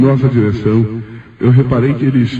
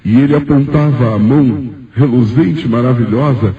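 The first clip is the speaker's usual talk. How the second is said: ordinary talk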